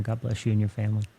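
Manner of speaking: ordinary delivery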